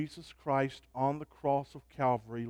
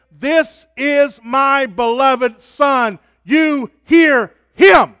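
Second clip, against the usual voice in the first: higher, louder, slow shouted proclamation; booming voice of God; 11 semitones higher, 18 dB louder, much slower than usual. Acted. Preacher shouting God's words from the cloud, slow and loud. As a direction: thunder it out. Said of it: "He says".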